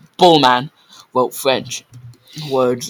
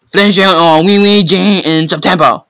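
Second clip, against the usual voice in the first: nervous fake French; 5 semitones higher, 9 dB louder than usual. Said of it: "Victor repeated".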